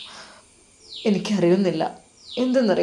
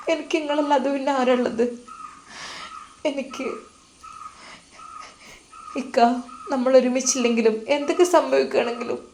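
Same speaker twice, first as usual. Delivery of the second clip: put-on tender young wife; 6 semitones higher than usual. Wife Nusaiba promising her husband lifelong love, high and hushed.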